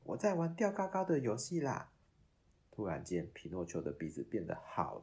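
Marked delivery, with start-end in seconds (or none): 0.1-1.9 fibbing puppet. Acted. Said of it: "只好骗仙女".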